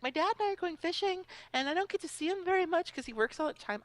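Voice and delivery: put-on high-pitched eager child; little boy voice. Boy telling opponent about his weekend, high child voice. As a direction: imitate a little kid, high and quick.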